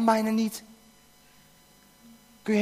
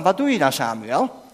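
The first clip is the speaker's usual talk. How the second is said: ordinary talk, nothing marked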